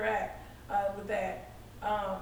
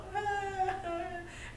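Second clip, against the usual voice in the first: a quick, hammed-up sob, pitched higher; mock sob; 8 semitones higher, 5 dB quieter than usual. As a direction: fake a little sob of breaking down. Breaking down on seeing the person.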